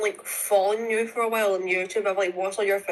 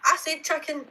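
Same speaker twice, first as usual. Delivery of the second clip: high Scottish protest, chicken close to "chuckin"; Scottish chicken, first vowel near "uh"; Scottish accent; 8 semitones higher than usual. Real, not acted. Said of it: "you're saying chucking".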